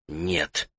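very soft, low reply; whispered denial. A narrator's reading. Captive denies hurrying to his mistress, whispering. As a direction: barely let the word out. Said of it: "прошептал Соэ".

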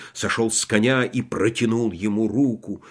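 ordinary delivery, nothing marked

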